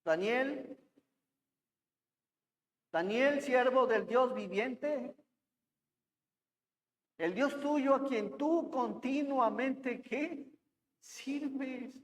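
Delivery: softly mournful call; voz triste. Acted. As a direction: read the king's words with soft, understated sorrow. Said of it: "con voz triste y le dijo". (con voz triste, contenida)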